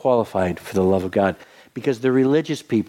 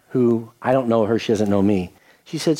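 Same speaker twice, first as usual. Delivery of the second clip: ordinary talk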